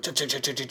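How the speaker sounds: quick choppy "ch" splash noises; imitated splashing. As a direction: imitate feet chattering on water. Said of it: "so it's like".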